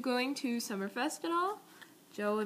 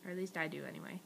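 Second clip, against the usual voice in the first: ordinary delivery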